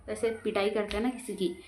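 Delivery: ordinary talk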